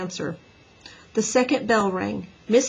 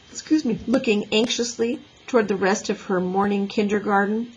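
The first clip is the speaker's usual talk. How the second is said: ordinary delivery, nothing marked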